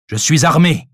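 loud, high warning; threatening shout. A narrator's reading. Hidden stranger threatening the doctor, shouted warning. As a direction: shout the threat to scare them off.

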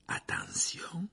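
slow whispered caution; hushed warning. A narrator's reading. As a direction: breathe the warning slowly. Countdown before spell.

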